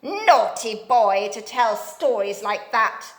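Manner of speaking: put-on loud, scolding old dame; loud frightening voice. A narrator's reading. Dame Snap scolds Joe, loud and frightening. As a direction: frighten him with a harsh scold. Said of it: "in such a loud and frightening voice".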